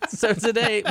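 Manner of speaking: slightly high restart; a touch squeaky; still a bit high. Real, not acted. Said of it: "my voice got so high right there".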